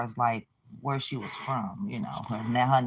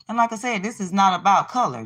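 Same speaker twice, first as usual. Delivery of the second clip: louder, higher insistence; heated rant; 6 semitones higher, 6 dB louder, faster than usual. Real, not acted. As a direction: press the point hard and loud.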